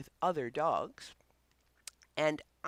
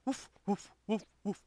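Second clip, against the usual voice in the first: a few light, lightly higher mock woofs; demo barking; 4 semitones higher than usual. Shown as a demonstration. Trainer showing owners how to bark at their pup, light mock woofs. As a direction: bark at the pup to get it going. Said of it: "then bark yourself".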